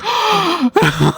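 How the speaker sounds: high sharp imitated gasp of realization; mock gasp. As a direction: gasp as if it just dawned on her. Wife realizes the prediction came true.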